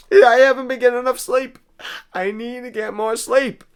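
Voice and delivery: loud, high emotional outburst; getting emotional. Real, not acted. Confessing to listeners about too little sleep, emotional. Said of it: "I apologize for getting a little emotional".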